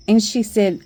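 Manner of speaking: ordinary talk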